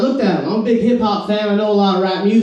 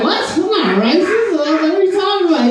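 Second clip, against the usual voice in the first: mock indignant surprise; 7 semitones higher than usual.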